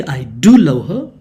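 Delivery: "do" punched loud in mid-sentence; emphatic DO. Shown as a demonstration. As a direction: hit "do" hard before "love". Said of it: "you stress that love".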